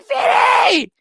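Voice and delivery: high, shouted imitation of the fan; mock excited yell. Streamer imitating an excited fan on screen, high mock yell. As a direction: ham up the fan's delight. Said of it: "Look, he's like".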